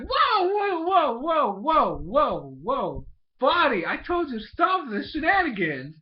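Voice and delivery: high, loud string of swooping whoas; mock alarmed protest. Brother stopping his little sister's matchmaking, mock alarm. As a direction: cut her off in hammed-up panic.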